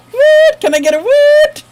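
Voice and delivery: high, loud hype call; hyped shout. Real, not acted. Streamer hyping a donation to chat, high and loud.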